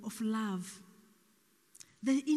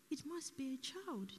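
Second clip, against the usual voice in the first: hushed, careful remark; 3 semitones higher, 10 dB quieter than usual. Retold or imitated. Speaker to crying mother, hushed and careful. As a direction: softly venture a painful guess. Hesitant words to grieving mother.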